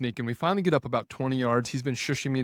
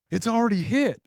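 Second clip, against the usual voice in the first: mock buddy voice; 11 semitones higher, 5 dB louder than usual. Quoted hunting buddy, high.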